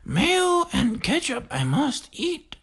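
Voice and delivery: high, slow put-on voice to hide a cracked voice; high, drawn-out goofy voice after the voice cracks; covering a voice crack. Real, not acted. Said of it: "playing it off 'cause my voice cracked".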